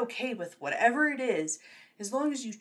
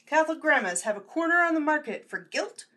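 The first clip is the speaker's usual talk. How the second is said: ordinary delivery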